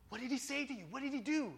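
high, urgent questions; acted-out excited kid; eager questioning. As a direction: press a friend for the news. Kids eagerly question friend.

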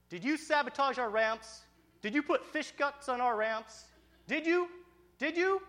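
loud, high-pitched confrontation; acted-out kids accusing someone; indignant accusation.

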